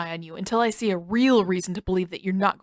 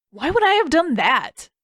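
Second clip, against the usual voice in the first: indignant outburst; 8 semitones higher, 5 dB louder than usual. Acted. Sokka indignant at Zuko.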